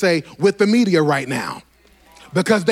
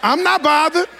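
higher and louder, triumphant shouting cadence; shouting preacher at peak; 13 semitones higher, 4 dB louder than usual. Acted. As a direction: preach it at full cry to the church.

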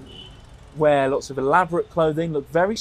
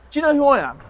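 [name] voice; 11 semitones higher, 6 dB louder, much faster than usual. Retold or imitated. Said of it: "who then asked Asif".

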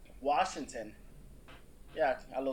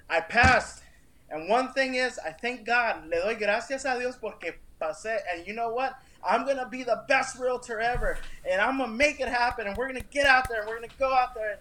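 higher, fired-up declaration; retold triumph; 7 semitones higher, 5 dB louder than usual. New realtor telling the test lady he passed, triumphant. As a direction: relive the victory, pitched up and determined.

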